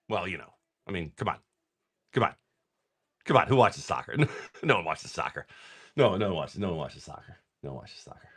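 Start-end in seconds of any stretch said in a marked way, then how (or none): 4.6-8.3 low mutter fading to almost nothing; dismissive muttering. Pretend. Streamer brushing off soccer to his viewers, dismissive mutter. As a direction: repeat it under your breath, ever softer.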